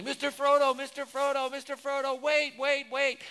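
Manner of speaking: high, loud calling after a friend; retold desperate yell. Pastor voicing Sam calling after Frodo, high shout. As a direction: shout his name across the water. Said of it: "he's yelling".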